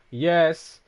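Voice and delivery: loud triumphant yes. Real, not acted. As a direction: punch the air with it. Cheering a game success.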